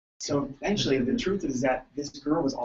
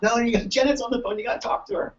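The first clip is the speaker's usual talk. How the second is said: higher pressing colleague; insist, pitched up; insistent colleague; 8 semitones higher than usual. Retold or imitated. Team member insisting he take Janet's call, high. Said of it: "It's like".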